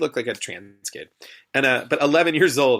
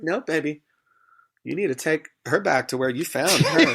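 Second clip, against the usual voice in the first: sassy dismissal; 4 semitones higher than usual. Retold or imitated. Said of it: "I was like".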